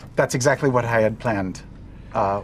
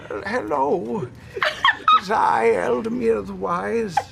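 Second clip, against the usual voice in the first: slow, altered sage voice, holding back laughter; Eldamir the Wise; 8 semitones higher, slower than usual. Acted. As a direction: speak as the wise old mage, slowly. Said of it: "transform my face and body into Eldamir the Wise".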